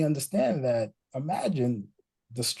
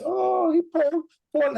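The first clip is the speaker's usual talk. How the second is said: put-on much higher, slow cooing baby talk; mock baby talk; 3 dB louder, slower than usual. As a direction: parents teasingly copying the child's words. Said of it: "a lot of parents I see, they have fun".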